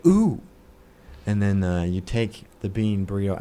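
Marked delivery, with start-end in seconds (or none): 0.1-0.4 sudden high loud ooh from a burn; pained ooh, much higher and louder; yelp of pain. Retold or imitated. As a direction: jump at the hot butter. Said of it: "You go".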